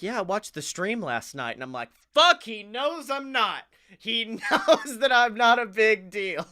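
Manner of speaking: normal talking voice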